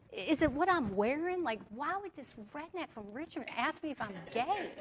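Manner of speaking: high, swooping offended complaint; acted indignation. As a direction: retell it as an affronted whine to friends.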